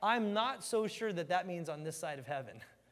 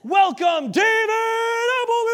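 high, loud slow grand announcement; loud booming heaven welcome; heavenly announcer; 16 dB louder than usual. Retold or imitated. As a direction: announce him like a stadium emcee. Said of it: "they're like".

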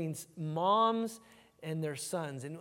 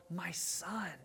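softer, affectionate mum saying "My son."; tender, softer mother's call; 10 dB quieter than usual. Shown as a demonstration. Preacher voicing a loving mother calling her son, softer and tender. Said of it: "You notice the affection?".

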